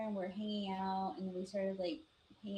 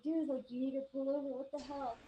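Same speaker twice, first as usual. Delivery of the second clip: higher-pitched, acted-out protest; alarmed insistence; 5 semitones higher than usual. Passenger insisting the driver stop the car, alarmed insistence. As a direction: press the driver urgently, voice raised in pitch.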